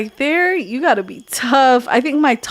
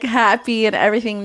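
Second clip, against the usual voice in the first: normal talking voice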